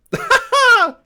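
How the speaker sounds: high loud giddy cackle; shrieking laugh. Real, not acted. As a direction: burst into a squealing laugh. Giddy after the win.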